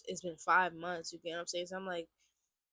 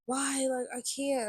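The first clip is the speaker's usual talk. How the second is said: high-pitched whiny mock crying; retold tearful protest, pitched up; 6 semitones higher than usual. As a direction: plead helplessly, imitating tears. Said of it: "And I was just crying".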